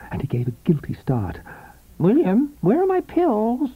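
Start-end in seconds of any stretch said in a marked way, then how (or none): 2.0-3.7 high, weak, peevish calling; peevish invalid wife. A narrator's reading. Sick wife calling her husband from her bed, peevish. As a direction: call out like a frail demanding wife. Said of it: "the voice demanded, peevish and despairing".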